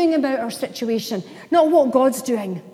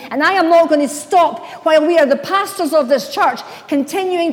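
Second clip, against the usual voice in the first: louder, impassioned pulpit declaration; full-force preaching; 2 semitones higher, 5 dB louder than usual. Acted. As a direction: preach it with every fibre, building to a cry.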